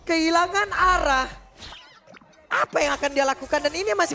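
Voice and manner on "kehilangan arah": loud, fast teamfight call; esports caster. Real, not acted. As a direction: shout the fight call like it's live. Live play-by-play of a teamfight.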